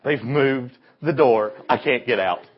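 searching the walls for a door that's gone; panicked girl. Retold or imitated. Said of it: "She goes".

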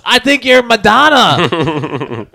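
loud, slow, laughing game-show shout; Ken Jeong shout. Retold or imitated. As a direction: shout the reveal over a laugh. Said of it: "I want Ken Jeong to loudly shout".

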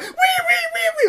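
very high, loud repeated squeals; imitated rabbit squeal. Imitating a rabbit caught in talons, squealing in pain. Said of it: "when they make a squeaking noise".

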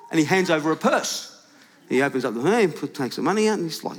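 ordinary talk, nothing marked

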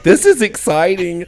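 high, loud fake thrill; mock excitement. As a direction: sell it as thrilling. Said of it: "you're like".

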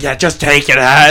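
loud, acted-out demand; mock aggressive creature. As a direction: act out a loud, creepy attacker. Creature forcing Morty, acted out.

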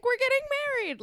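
very high, loud couple's squeal; overexcited newly engaged voice; mock excited announcement. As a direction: gush the big news, hammed-up.

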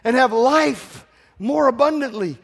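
ordinary talk

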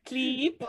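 "Cleep" with a long ee vowel. Retold or imitated. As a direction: say "Cleep" like "cleep", mocking. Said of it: "And then I was like".

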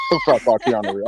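ordinary talk, nothing marked

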